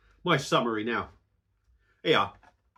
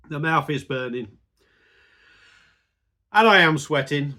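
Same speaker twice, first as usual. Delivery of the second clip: ordinary delivery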